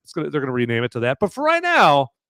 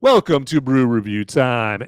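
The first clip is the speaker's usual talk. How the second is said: show announcer; 3 semitones higher, 4 dB louder, slower than usual. Pretend. Host opening brew review time to viewers, announcer style. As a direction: stretch it out like a show opener.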